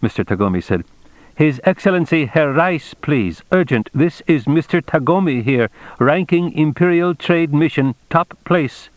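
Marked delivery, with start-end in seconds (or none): 1.3-8.9 raised pitch, firm official demand; hard, businesslike insistence, pitched up; hard, no-nonsense. A narrator's reading. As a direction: announce your rank in a no-nonsense voice. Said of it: "He used his hard, no-nonsense voice".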